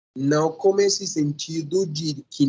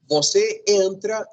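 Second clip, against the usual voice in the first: Você entra, raised and slowed as an example; example sentence; 6 semitones higher than usual. Shown as a demonstration. Teacher voicing a sample present-tense sentence. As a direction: set off Você entra high and slow. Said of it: "Então, você diz".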